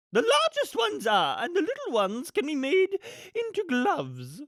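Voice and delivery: high, swooping grande-dame villain voice; loud, theatrical and callous schemer; imperious villainess. Acted. Acts the villainess Cruella.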